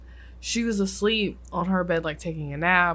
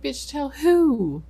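incredulous; 8 semitones higher, 3 dB louder, slower than usual. Retold or imitated. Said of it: "I'm like".

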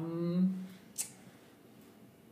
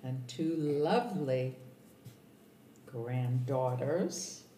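slow and deliberate; much slower than usual. Real, not acted. Mom lists her family slowly.

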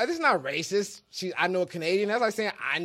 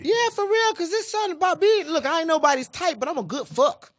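pitched way up, laughing boast; excited; 8 semitones higher than usual. Real, not acted. Bragging to friends, voice jumps up high. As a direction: brag it out high and giddy.

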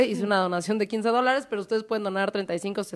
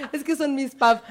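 entre risas, fingiendo un lamento; riendo; 4 semitones higher than usual. Retold or imitated. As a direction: queja cómica entre risas. Imitando quejándose entre risas. (riéndose)

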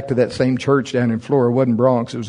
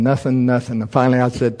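ordinary delivery

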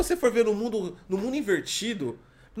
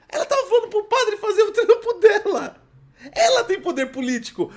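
laughing; 11 semitones higher, 6 dB louder than usual. Real, not acted. Mocking the politician, laughing.

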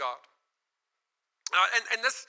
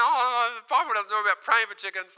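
louder, higher mock protest; indignant protest; 4 semitones higher, 6 dB louder than usual. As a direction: retell it as an exasperated objection. Acted protest about praying for chickens.

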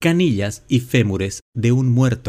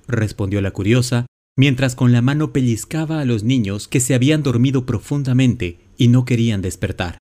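plain reading voice

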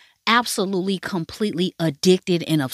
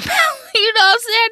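high, loud laughing delivery; 5 dB louder than usual. Real, not acted. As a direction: burst out laughing mid-sentence and talk through it. Laughs at own crude retelling.

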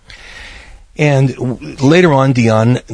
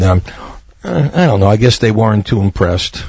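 ordinary delivery

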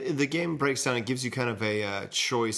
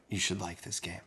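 ASMR voice; 5 semitones lower, 9 dB quieter than usual. Real, not acted. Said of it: "A little A S M R voice from [name]".

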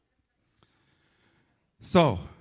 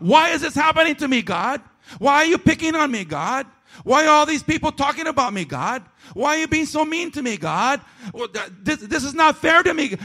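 much higher and louder, petulant wail; whiny angry complaint to God; 12 semitones higher, 6 dB louder than usual. Pretend. Pastor acting out complaining to God, mock petulant wail. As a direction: pretend to throw a tantrum at God. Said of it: "have an attitude with God and get mad at God".

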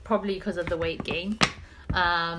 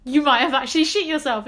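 raised, louder alarmed realisation; shocked; 6 semitones higher, 7 dB louder than usual. Retold or imitated. Telling the story of her friend, a half-mocking horrified exclamation. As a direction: blurt it out in retold horror.

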